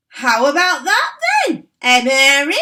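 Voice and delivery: put-on high, loud old woman's shout; shouting grandma. A narrator's reading. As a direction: bellow it down from the roof, gleeful. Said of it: "Grandma shouted".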